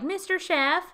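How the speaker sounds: high, mocking call to the chef; demanding diner. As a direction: imitate a pestering customer. Diners accosting the busy chef.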